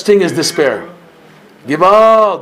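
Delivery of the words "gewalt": cry out. Shown as a demonstration. Preacher crying out Gewalt.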